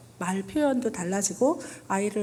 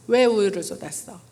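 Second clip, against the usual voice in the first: louder scolding demo; scolding question; 5 dB louder than usual. Shown as a demonstration. Parent scolding a child who spilled milk, louder scold. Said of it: "그 다음 하나만 더 할게요".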